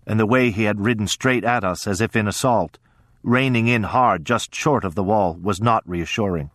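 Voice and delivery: ordinary delivery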